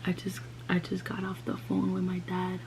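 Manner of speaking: hushed, broken whisper to mom; barely able to say it, head down. Retold or imitated. Telling mom, head down, crying.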